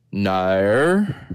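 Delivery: drawn-out Australian "Nair" with rising tune; Australian accent. Shown as a demonstration. Host warming up an accent for listeners, Australian accent. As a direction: try an Australian "Nair", sliding upward. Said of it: "So Australian".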